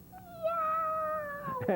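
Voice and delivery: high drawn-out meow; cat meow. Acted. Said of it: "the cats went".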